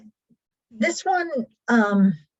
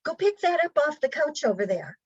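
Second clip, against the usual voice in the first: vague instruction; 6 semitones higher, faster than usual. Retold or imitated. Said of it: "instead of saying".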